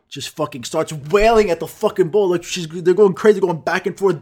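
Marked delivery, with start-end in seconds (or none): none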